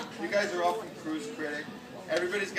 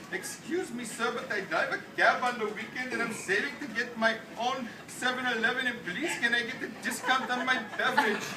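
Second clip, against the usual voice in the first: mock Indian accent, pitched up a bit; put-on Indian accent; 6 semitones higher than usual. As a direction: fake an Indian accent to get a discount. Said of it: "I say to the guy".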